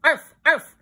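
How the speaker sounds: high, loud playful arf arf; happy barking. A narrator's reading. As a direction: yap twice like an eager dog. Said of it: "barking happily".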